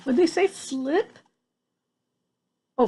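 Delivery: usual speaking voice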